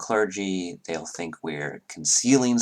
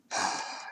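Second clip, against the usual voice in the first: audible breathy sigh; weary sigh; 9 dB quieter than usual. Acted. Duchess sighs before replying to the party, weary sigh. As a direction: let out a sigh before answering. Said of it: "she just sighs".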